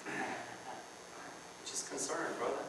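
slow whispered fake concern, just breath; mock concerned whisper. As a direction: hush it like a gossip pretending to care. Gossip feigning concern.

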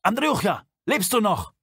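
loud, high anxious call; older man calls out to a friend in the dark. A narrator's reading. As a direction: shout down the tunnel for a missing comrade. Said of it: "und rief".

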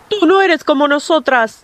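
lightly voiced old whale; a hint of a stern whale. A narrator's reading. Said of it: "le dijo la ballena".

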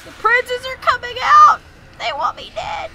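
high, loud frantic yells; panicked shouting. Real, not acted. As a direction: scream in panic. Enemies swarm, he screams.

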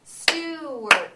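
"Stewart" loud, split into two clapped beats; clapped syllables. Shown as a demonstration. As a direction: clap out each syllable of "Stewart", loud and even. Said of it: "Now let's do it together".

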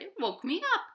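high, eager little puppy; puppy's voice. A narrator's reading. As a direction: pitch way up like a small excited dog. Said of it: "he said".

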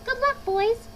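high, loud farewell call; crying out as Lorax. A narrator's reading. Lorax sending the Barbaloots away, crying out. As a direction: call it out high to the departing creatures. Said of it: "he cried".

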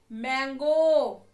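"Mango" drawn out very slowly and loud; slow emphatic repeat. Shown as a demonstration. Teacher repeating "mango" slowly after asking the class. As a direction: stretch "mango" so kids hear the "म". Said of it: "क्या बोला हमने?".

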